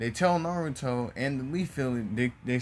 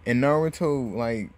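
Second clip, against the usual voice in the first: plain reading voice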